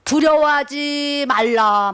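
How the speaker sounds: slow, loud, booming divine voice; imitating God's command from a loudspeaker. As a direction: proclaim it like a voice over a huge speaker. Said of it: "흉내를 내는, 내는 거예요, 제가".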